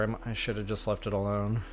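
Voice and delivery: usual speaking voice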